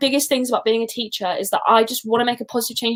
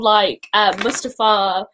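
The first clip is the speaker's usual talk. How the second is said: normal talking voice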